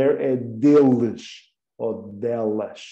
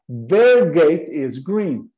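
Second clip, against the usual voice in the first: slow model sentence; much slower than usual. Shown as a demonstration. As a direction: repeat it clearly for the class. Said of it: "duas irmãs".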